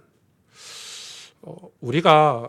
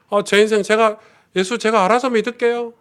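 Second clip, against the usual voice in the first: raised, louder retort of a member pushing the pastor away; indignant mock reply; 7 semitones higher, 5 dB louder than usual. Member pushing the pastor away, indignant raised retort. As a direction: throw it back at him, brushing off the pastor. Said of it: "그 말이 제일 가슴이 아프거든요".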